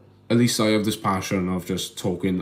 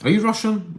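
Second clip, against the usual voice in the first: surprised question; 12 semitones higher than usual. Real, not acted.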